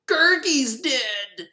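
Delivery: high, slow, overwrought wail; mock grief. Friends mourning Gurgi, hammed-up tragic wail. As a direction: ham up the tragedy.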